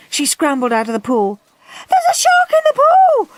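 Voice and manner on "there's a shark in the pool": very high, loud panicked screech. A narrator's reading. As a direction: shriek the alarm. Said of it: "screeched anxious Andrew".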